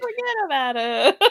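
giggly imitation of a New Yorker; laughing New York accent. Co-host echoing the joke, laughing New York accent. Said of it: "hired someone from New York".

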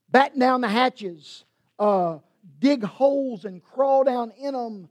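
loud, high mock panic; preacher mocking alarmist talk with loud, high panic. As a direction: ham up the fearmongers. Mocking fear-driven alarmist messages.

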